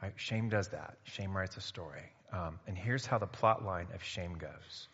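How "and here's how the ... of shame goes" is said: normal talking voice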